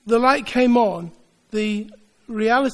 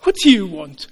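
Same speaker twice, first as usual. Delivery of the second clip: \"What do you want?\" snapped out louder, a challenge; demanding; 6 dB louder than usual. Shown as a demonstration. Preacher acting Jesus as a sharp demand, louder. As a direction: fire \"What do you want?\" at them like a confrontation. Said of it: "You can go".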